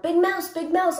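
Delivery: high, loud call for Big Mouse; little mouse yelling about a ghost, high and loud; frightened yell. A narrator's reading. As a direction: yell for help, scared of the dark. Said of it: "he yelled".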